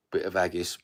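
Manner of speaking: usual speaking voice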